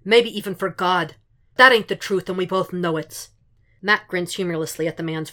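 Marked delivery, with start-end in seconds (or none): 0.0-3.3 loud, booming contempt; thundering Fisk. Acted. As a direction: boom it across the room. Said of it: "Fisk, loud and thundering".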